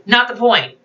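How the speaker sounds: dry, flat dismissal; a flat, bored brush-off; flatly. A narrator's reading. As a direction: shut the interruption down without feeling. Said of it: "Edie said flatly".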